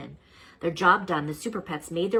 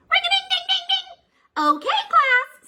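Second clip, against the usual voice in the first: much higher, louder, slow sing-song teacher voice; cheery teacher; 9 dB louder, slower than usual. Acted. Miss Beasley calling her class to order, high sing-song. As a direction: ring it out like a bell to start the day.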